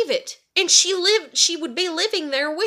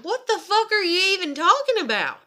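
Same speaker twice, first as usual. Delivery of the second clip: higher-pitched incredulous retort; mildly heated quoted outburst; retold exasperation; 6 semitones higher, 3 dB louder than usual. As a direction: replay a fed-up comeback to relatives. Quoting fed-up retort to relatives.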